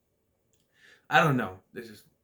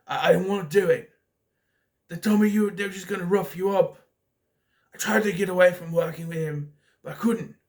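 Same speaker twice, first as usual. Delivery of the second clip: higher, louder protest in a retold movie scene; acted-out confession; 5 semitones higher, 5 dB louder than usual. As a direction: plead your excuse as the character, hammed up. Acts out film character's pleading confession.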